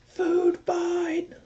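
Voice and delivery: high squealing cry of food fight; playful shout. Acted. Streamer yelling at chat to start a food fight, playful shout.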